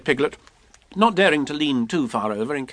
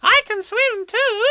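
put-on very high, drawn-out child's boast; proud little squeak; proud Roo; 3 dB louder than usual. A narrator's reading. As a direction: show off in a tiny piping voice. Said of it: "said Roo proudly".

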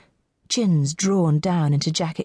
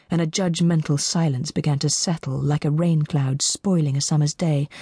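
ordinary delivery, nothing marked

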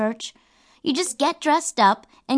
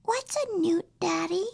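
put-on hushed, high little-girl question; a small girl quietly asking her dad; soft, high child voice; child's whisper; 8 semitones higher, 7 dB quieter than usual. A narrator's reading.

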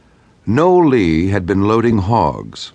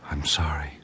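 whispering; 2 semitones lower, 10 dB quieter than usual. A narrator's reading. Wounded wife whispering to dispatcher.

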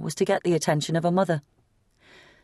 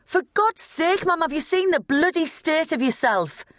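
cried out; 13 semitones higher than usual. A narrator's reading. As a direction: cry it out at her, pitch way up. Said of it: "he cried".

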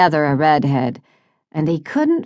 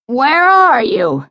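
loud, high, drawn-out angry demand; bellowing; 10 semitones higher, 5 dB louder, slower than usual. A narrator's reading. Father on the phone to his son, bellowing. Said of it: "bellowed into the phone".

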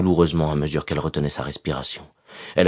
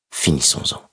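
whispered. Acted. Whispered plea, knife at throat.

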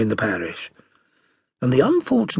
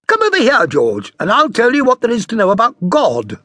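put-on high, louder call to the child; mother summoning George for a lesson, high and loud; 10 semitones higher, 7 dB louder than usual. A narrator's reading. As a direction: call the boy over, bright and high. Mother calls the boy over.

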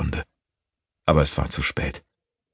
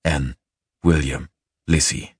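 usual speaking voice

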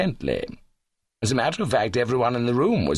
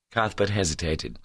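plain reading voice

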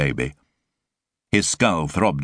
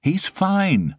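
dismissive woman. Acted. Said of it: "she said, impervious".

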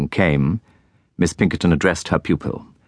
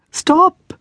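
loud, much higher cry of stop; cried out; 5 dB louder than usual. A narrator's reading. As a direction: call after the carriage in a panic. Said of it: "cried Miss Jemima".

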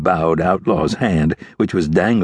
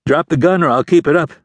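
remorseless; 9 semitones higher, 3 dB louder than usual. A narrator's reading. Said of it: "the ranger said with no remorse".

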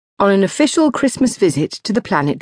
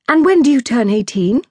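put-on higher, brighter young woman asking; a lightly voiced curious Zoe; 7 semitones higher, 3 dB louder than usual. Zoe asking the princess her age, lightly voiced.

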